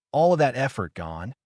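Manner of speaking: usual speaking voice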